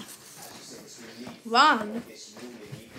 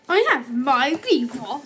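ordinary talk, nothing marked

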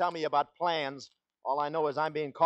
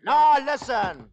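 high, loud and slow; desperate loud plea; panicked plea; 9 semitones higher, 8 dB louder, much slower than usual. Acted. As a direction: drag it out in desperation. Pleads while being carried off.

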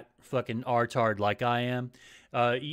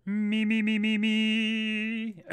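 vocal warm-up; 8 semitones higher, much slower than usual. Shown as a demonstration. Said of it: "I'm trying to improve my newscaster voice".

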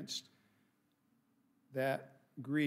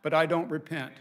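a sudden loud, defiant jab; defiant loud aside; 2 semitones higher, 9 dB louder than usual. Real, not acted. Pastor defiant to the congregation, loud jab. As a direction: punch it out, unapologetic. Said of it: "I'm sorry, that's not the right thing".

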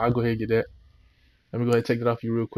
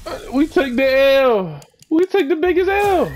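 dismayed yell; 13 semitones higher, 5 dB louder than usual. Real, not acted. Bad pack pull, dismay.

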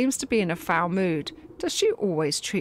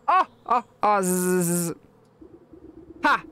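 buzzing laugh; 2 semitones lower than usual. Acted. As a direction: cackle then buzz like a bee. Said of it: "lets out a buzzing laugh".

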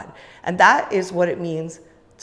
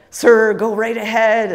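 hearty retold welcome; 4 semitones higher, 9 dB louder, slower than usual. Retold doormen welcoming a guest.

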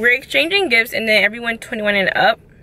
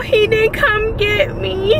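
much higher, slow, thrilled relief; squealing relief; 11 semitones higher, slower than usual. Real, not acted. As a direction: squeal it out, overjoyed. Repeats, much higher and slower.

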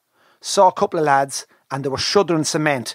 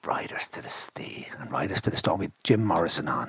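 whispered aside; 8 semitones lower, 7 dB quieter than usual. Real, not acted. Quiet aside about the music.

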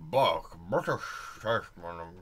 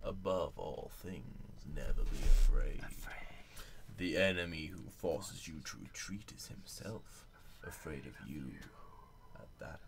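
hushed dramatic whisper; 5 semitones lower, 5 dB quieter than usual. Acted. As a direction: whisper it solemnly. Quoting game loading tip.

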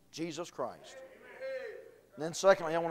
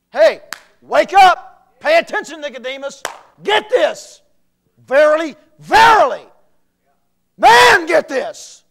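loud, much higher slow shouted call; preaching at full force; 9 semitones higher, 18 dB louder than usual. Real, not acted. Preacher voicing the call to Nicodemus, full-force shout. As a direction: shout to wake the listener up.

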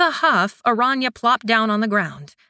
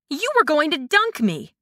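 higher, accusing outburst; half-laughing protest of betrayal; indignant cry; 6 semitones higher than usual. A narrator's reading. Aranya catching Kai's plan, indignant higher cry. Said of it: "she cried".

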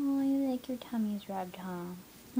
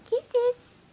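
high, loud squeal; 13 semitones higher, 7 dB louder than usual. Real, not acted. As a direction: squeal it happily. Asking puppy for kisses.

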